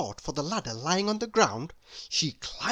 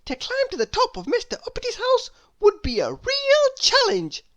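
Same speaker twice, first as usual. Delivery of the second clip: put-on very high, bright and louder little girl; Little Miss Somersault; 4 dB louder than usual. A narrator's reading. Little Miss Somersault brushing off Mister Worry, very high and loud. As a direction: chirp it way up high. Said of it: "said Little Miss Somersault".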